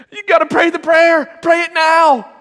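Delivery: high, loud urgent command; hammed-up desperate urging; frantic retold yell. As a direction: yell it like a kid in a spiritual emergency. Child urgently ordering brother to pray.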